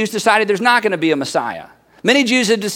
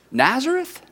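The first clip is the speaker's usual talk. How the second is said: higher, softer, rising question; skeptical; 4 semitones higher, 5 dB quieter than usual. Acted. Nathanael states his second objection, skeptical. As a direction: let the town name hang in doubt. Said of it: "Nathanael is a little bit skeptical".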